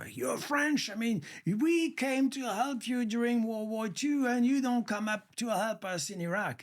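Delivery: high, mocking American voice; American scolding a Frenchman, high mocking voice. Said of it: "people know".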